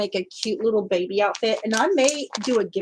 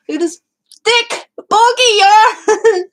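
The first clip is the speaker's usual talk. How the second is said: thrilled outburst; 12 semitones higher, 13 dB louder than usual. Real, not acted. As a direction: stretch each word in excitement. Thrilled showing off gift yarn.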